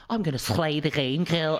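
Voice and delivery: high-pitched mock Scouse drag queen. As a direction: put on a Liverpool queen's accent. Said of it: "Liverpool queens talking like that".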